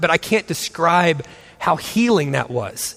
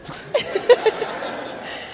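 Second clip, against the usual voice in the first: high squeal laugh; 5 dB quieter than usual. Real, not acted. Laughing at herself.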